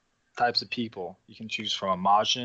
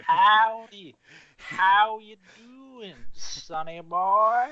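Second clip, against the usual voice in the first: higher, slow Southern drawl with stretched vowels; Southern accent; 9 semitones higher, 4 dB louder, much slower than usual. Shown as a demonstration. Showing his friend how to do a Southern drawl, slow. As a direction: draw out them vowels like an old cowboy. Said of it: "you just gotta draw out them vowels".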